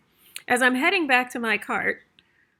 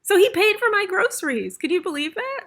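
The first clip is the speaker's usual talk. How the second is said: higher and louder, thrilled; amazed; 10 semitones higher, 3 dB louder than usual. Real, not acted. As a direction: share the amazing news. Amazed stranger paid for groceries.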